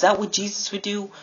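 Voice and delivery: high-pitched rhetorical challenge; challenging question. Retold or imitated. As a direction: pitch it high, confronting a friend.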